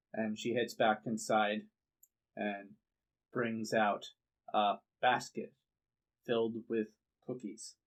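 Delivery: ordinary talk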